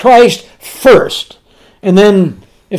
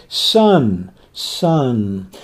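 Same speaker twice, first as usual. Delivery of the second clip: put-on soft and tender, like a mother to her child; 7 semitones lower, 7 dB quieter than usual. Shown as a demonstration. Preacher showing how Jesus called the paralytic, softly tender. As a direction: murmur the word lovingly and softer. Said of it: "it's full of compassion".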